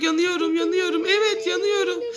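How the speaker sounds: high squealing pretend pain; mock burning squeal. Hot-cold game, pretends to burn.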